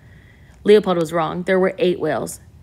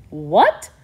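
shouted; 3 dB louder than usual. A narrator's reading. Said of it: "Wolf shouted".

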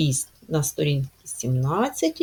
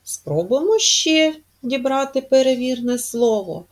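usual speaking voice

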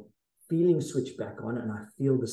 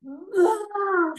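sudden high loud cry, much higher; imitated primordial roar; 6 dB louder than usual. Retelling the moment he burst apart, a high loud cry. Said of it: "I feel this roar".

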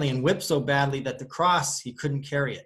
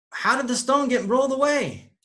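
surprised women; 11 semitones higher, 4 dB louder than usual. Retold or imitated. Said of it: "And they're like".

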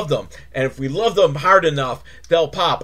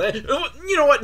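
normal talking voice

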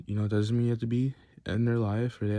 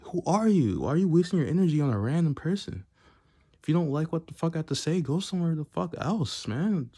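real angry rant, loud and higher-pitched; annoyed outburst; 7 semitones higher, 4 dB louder than usual. Real, not acted. Telling off a random online commenter, annoyed outburst. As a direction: tear into them, pitched up and indignant. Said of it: "oh, I get annoyed".

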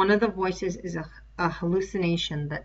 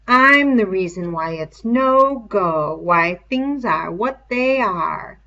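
much louder, slow ominous pronouncement; menacing declaration; 9 dB louder, slower than usual. Acted. The beast telling Simon why things are, loud and slow. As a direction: proclaim it like a dark god.

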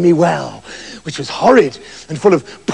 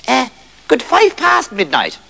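slow, slightly higher elf voice; christmas elf; 5 semitones higher than usual. Acted. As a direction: drawl it like a sleepy gnome. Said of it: "it said".

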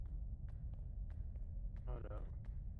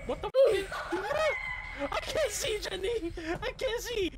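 high panicked pleading; 13 semitones higher than usual. Real, not acted. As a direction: panic in the dark, half laughing. Dropped flashlight in dark, panicking.

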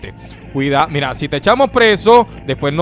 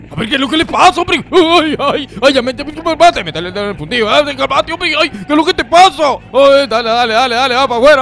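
high, indignant outburst; agitated protest; 4 semitones higher, 3 dB louder than usual. Acted. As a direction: protest loudly while fending people off. Acted scuffle, protesting being grabbed.

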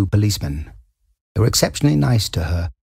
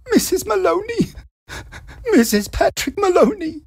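very high, frantic naming herself; panicked. A narrator's reading. Wife giving her name to the police, frantic high voice.

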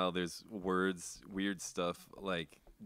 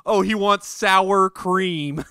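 much higher, louder, drawn-out taunt; mocking high slow voice; 11 semitones higher, 14 dB louder, much slower than usual. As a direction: sing it out to mock him.

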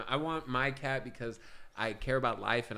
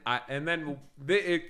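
ordinary delivery

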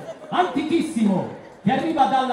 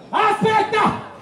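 grido improvviso; 10 semitones higher, much slower than usual. Real, not acted. Ferma il bambino di colpo.

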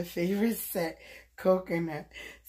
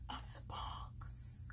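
hushed, breathy aside to viewers; playful whisper; 18 dB quieter, slower than usual. Real, not acted. Vlogger whispering to viewers as she starts unwrapping, hushed. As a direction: whisper it like a secret to the camera.

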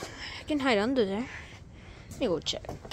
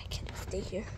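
whisper; 3 semitones lower, 11 dB quieter than usual. Real, not acted. Hiding from the seeker.

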